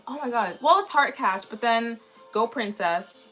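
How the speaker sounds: usual speaking voice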